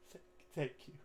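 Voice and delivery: stammered, tearful thanks; weepy, broken voice; acted crying. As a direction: choke it out through acted sobs. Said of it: "he just starts crying".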